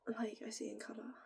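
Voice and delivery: soft unvoiced whisper on "I see in colour". Real, not acted. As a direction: breathe "I see in colour" like a secret. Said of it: "I always say it like that".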